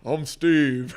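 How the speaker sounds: put-on voice of a kid playing dad; Steve. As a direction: introduce yourself as a mock dad. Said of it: "he kinda looks like me".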